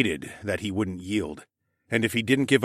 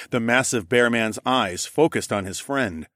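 ordinary delivery, nothing marked

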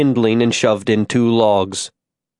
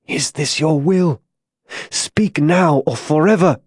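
higher, urgent appeal to heaven; strained, pleading demand; desperate prayer; 5 semitones higher than usual. Acted. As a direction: plead with God, pitch lifted. Desperate last appeal to God.

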